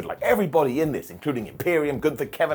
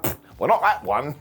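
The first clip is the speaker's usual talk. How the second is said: dismissive fans. Retold or imitated.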